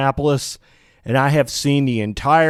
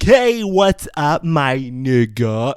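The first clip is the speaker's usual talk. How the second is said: mock faked street accent; slower than usual. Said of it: "really just excruciating to hear".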